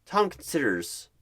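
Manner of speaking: usual speaking voice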